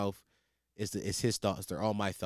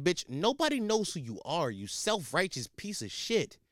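angry rant; 6 semitones higher than usual. Real, not acted. Ranting at ex-girlfriend.

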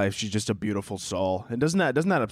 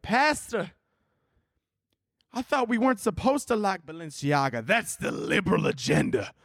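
mock outraged congregant; 7 semitones higher than usual. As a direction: protest to the pastor in a hammed-up voice. Acted outraged congregant confronting pastor.

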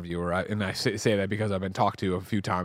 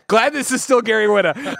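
high, loud laughing aside; amused; 11 semitones higher, 7 dB louder than usual. Retold or imitated. Host delighted by Gary's rant, laughing. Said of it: "I was like".

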